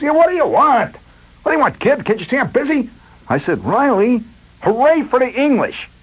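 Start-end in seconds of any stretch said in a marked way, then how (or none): 0.0-2.9 high, impatient foreman's bark; gruff, busy foreman. Retold or imitated. Riley impatient with the kid at his vats, high gruff bark. 3.6-5.9 calling out. Retold or imitated. Calling the phrase over the noise.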